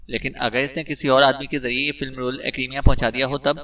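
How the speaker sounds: usual speaking voice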